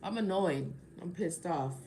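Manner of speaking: normal talking voice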